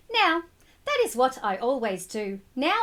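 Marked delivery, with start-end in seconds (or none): none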